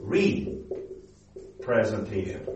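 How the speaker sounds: "Representation" very slowly, ree, pause, presentation; separated RE prefix. Shown as a demonstration. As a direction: split "Representation" after a long "ree". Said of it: "re's and reps".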